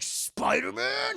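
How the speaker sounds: high, loud, drawn-out outrage; hammed-up angry cry; mad outburst. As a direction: screech it in mock fury. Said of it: "He's very mad about Spider-Man".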